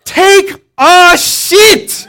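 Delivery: father mishears as he yells at his son; full-pitch shout. Retold or imitated. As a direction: scream it at the eldest son. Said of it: "it's like full pitch".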